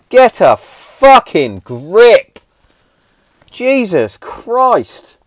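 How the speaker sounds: loud, slow, high exasperated outburst; drawn-out angry exasperation; exasperated rant. Real, not acted. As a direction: spit it out, fed up. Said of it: "Rant over on that one".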